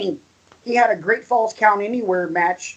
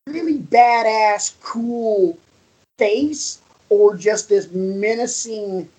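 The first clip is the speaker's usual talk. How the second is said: normal talking voice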